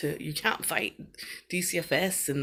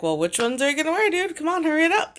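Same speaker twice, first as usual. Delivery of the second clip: higher, louder, fast nagging mom; impatient hurry-up; 11 semitones higher, 8 dB louder, faster than usual. Retold or imitated. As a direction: rush the kid along, loud and quick. Mother voicing herself urging son.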